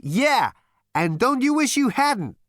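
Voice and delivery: high, loud, brash character voice; Zaphod. Acted. Zaphod's figure voice in the story.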